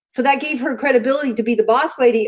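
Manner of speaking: usual speaking voice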